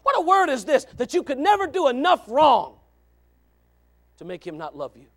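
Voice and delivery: normal talking voice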